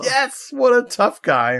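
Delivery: high, laughing mock praise; laughing sarcasm. Real, not acted. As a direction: laugh through the sarcastic compliment. Mocking the dad's line.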